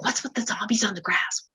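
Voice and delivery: hushed whisper about the meditators. Retold or imitated. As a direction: whisper it so they won't hear. Husband whispering at workshop.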